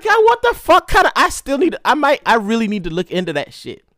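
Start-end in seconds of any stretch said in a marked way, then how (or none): 0.0-1.1 high, loud disbelief; exasperated outburst. Retold or imitated. Exasperated after escape.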